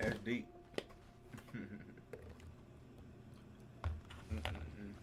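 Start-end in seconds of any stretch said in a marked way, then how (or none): 0.5-4.3 breathless, voiceless laughter; silent wheezing laugh. Real, not acted. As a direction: laugh with almost no voice. Breathless laugh after a joke.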